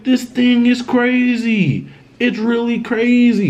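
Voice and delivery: high-pitched astonished exclamation; retold amazement.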